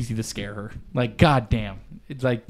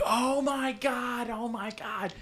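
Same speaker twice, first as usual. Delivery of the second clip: panicked scream; 9 semitones higher, 12 dB quieter, slower than usual. Retold or imitated. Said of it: "starts screaming".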